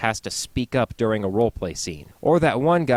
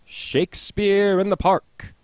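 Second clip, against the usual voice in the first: grand announcer; 8 semitones higher, slower than usual. Acted. Header, grand slow.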